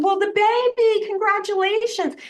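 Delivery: high, bright-eyed gushing congratulations; excited congratulations. Retold or imitated. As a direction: gush at her like great news. Retold blunder congratulating on baby.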